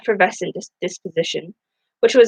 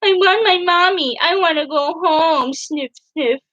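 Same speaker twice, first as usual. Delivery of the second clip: high whiny pretend crying; mock sobs; 10 semitones higher, slower than usual. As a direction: whimper like a fake crybaby, pitch way up. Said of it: "in mock sobs".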